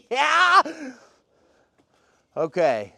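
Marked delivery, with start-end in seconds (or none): 0.0-0.6 high, loud laughing yeah; tearful laughing. Real, not acted. Answering a friend who asks if he's crying, tearful laugh. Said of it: "you crying already?".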